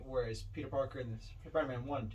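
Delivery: ordinary talk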